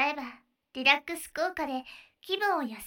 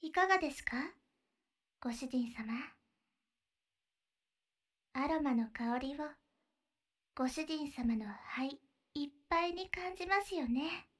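guided breathing; 3 semitones lower, 7 dB quieter than usual. Acted. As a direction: lead the breathing gently, half whispered. Leads a breathing exercise.

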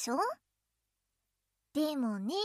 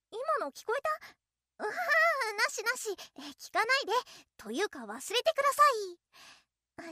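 flustered; 5 semitones higher, faster than usual. Acted. Embarrassed after slip, flustered.

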